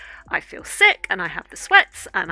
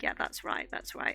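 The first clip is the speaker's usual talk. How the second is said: quiet muttered agreement; 12 dB quieter than usual. Retold or imitated. Retelling her own reply.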